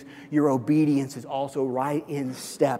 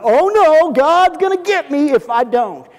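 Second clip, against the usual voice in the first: high, loud panicked wail; mock cowering fear; 11 semitones higher, 11 dB louder than usual. Pastor mocking fearful obedience, high loud panic. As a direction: ham up a terrified believer. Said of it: "This isn't cowering fear".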